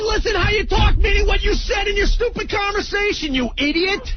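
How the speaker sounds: high, exasperated insult over the caller; angry outburst. Real, not acted. Radio host berating an arguing caller, angry outburst.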